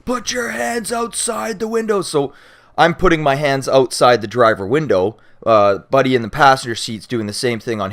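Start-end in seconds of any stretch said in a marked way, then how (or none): 0.0-2.0 high-pitched barking of orders through a megaphone; loudspeaker yelling. Retold or imitated. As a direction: yell it like a cop on a loudspeaker. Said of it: "on their loudspeaker and start yelling".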